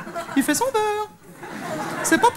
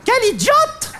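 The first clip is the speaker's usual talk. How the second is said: mock cue delivery; 5 semitones higher, much slower than usual. Shown as a demonstration. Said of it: "quand je dis quel idiote, il y a un noir".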